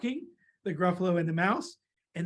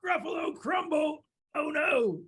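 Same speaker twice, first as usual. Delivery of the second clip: high, horrified storybook monster; hammed-up dismay, pitched high; mock alarm; 8 semitones higher than usual. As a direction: cry out in pretend dread. Gruffalo horrified at being the food.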